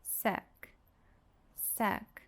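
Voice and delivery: "suc" softly, with a lazy uh; soft unstressed "suc", vowel reduced; schwa syllable. Shown as a demonstration. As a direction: say "suc" as a short weak sək. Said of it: "Aqui o schwa sound".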